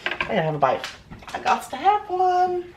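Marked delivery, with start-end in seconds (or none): 1.3-2.6 squeaky high voice. Pretend. Craving a bite.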